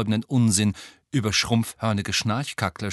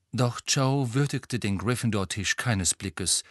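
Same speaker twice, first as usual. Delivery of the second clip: usual speaking voice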